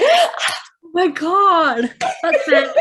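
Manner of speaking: high, loud, drawn-out oh my God; delighted exclamation. Real, not acted. Host reacting to guest's praise, delighted and gushing. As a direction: gush with delight at the compliment.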